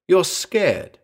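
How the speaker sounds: high-pitched, pointed accusation; accusing. A narrator's reading. Albino to the man under the table, accusing. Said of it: "she accused".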